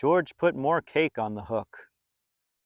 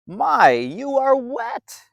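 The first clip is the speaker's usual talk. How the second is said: lightly voiced boy, higher and slow; a friendly boy teasing gently; a hint of a cheerful kid friend; Bill's voice; 10 semitones higher, 5 dB louder, slower than usual. Bill speaks to wet George.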